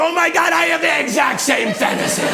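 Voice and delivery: high, exclaiming comic cry; mock thrilled outburst. President learns they share a fantasy, high thrilled cry. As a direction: burst out in hammed-up amazement, slowing at the end.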